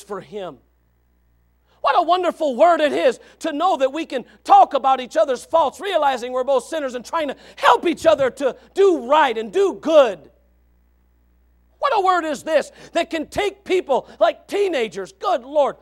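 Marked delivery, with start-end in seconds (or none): 1.8-13.6 loud, higher preaching; preaching shout. Real, not acted. Preacher exhorting the church to help each other, shouted. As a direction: shout it over the room.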